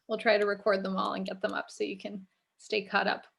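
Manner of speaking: ordinary delivery, nothing marked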